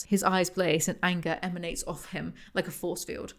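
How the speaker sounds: ordinary delivery, nothing marked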